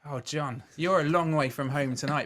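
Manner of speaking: ordinary talk, nothing marked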